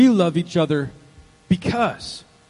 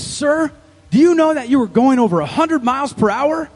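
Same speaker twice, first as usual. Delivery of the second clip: raised, louder officer's voice; stern cop; 5 semitones higher, 6 dB louder than usual. Acted. Cop questioning a speeding husband at the roadside, stern. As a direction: confront the driver like a traffic cop. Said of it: "the cop pulls up".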